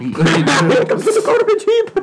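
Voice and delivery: slow, high leprechaun voice breaking into laughter; mock leprechaun, giggling through it; laughing leprechaun voice. Repeating the radio host bit, laughing in leprechaun voice. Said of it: "like a high pitched, like leprechaun voice".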